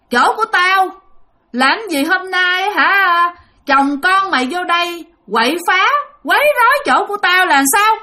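high, drawn-out angry ghost woman; possessing woman spirit. Retold or imitated. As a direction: scold intruders in a high spirit voice. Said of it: "là giọng của một người đàn bà".

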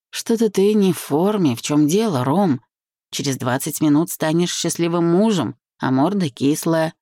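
ordinary delivery, nothing marked

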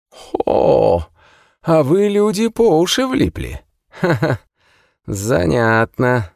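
high, amused cackling prisoner; amused immortal prisoner. Acted. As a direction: savour their trouble slowly. Prisoner answers, laughing. (насмешливый бессмертный, высоко)

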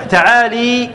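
تعالي said ta'ālī with a long "ee" ending; common-error kasra. Shown as a demonstration. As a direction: give the lam of تعالي an "ee" vowel. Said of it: "خطأ شائع".

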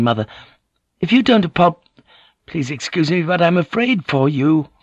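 a nervous son stumbling for words; anxious youth. Acted. Son pleads with his mother.

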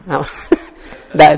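ordinary talk, nothing marked

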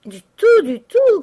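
high, very loud cry of refusal; crowd shout. A narrator's reading. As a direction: shout it out like a roomful of angry lodgers. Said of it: "crièrent les pensionnaires".

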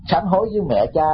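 ordinary delivery

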